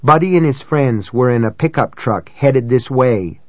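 usual speaking voice

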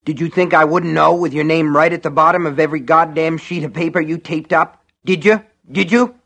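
building into a furious yell; angry shout. Acted. Buster yelling at Norris.

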